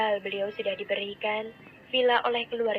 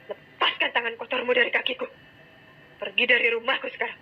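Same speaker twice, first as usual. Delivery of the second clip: louder, faster furious father throwing her out; furious order; 2 semitones higher, 5 dB louder than usual. A narrator's reading. Father orders crying daughter out of house, furious. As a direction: bark the order to leave. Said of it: "Nada tegas penuh kemarahan".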